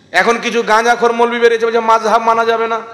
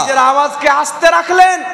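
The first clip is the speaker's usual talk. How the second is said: higher, louder, shouted out to the crowd; loud preaching shout; 5 semitones higher than usual. Shown as a demonstration. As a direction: call the line out loud like a preacher. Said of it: "জোরে হবে না সুবহানাল্লাহ?". (জোরে চিৎকার করে বলা)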